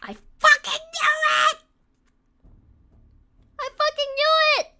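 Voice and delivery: shrieked high and very loud; screaming in fright. Real, not acted. Streamer reacting to a jump scare, shrieking.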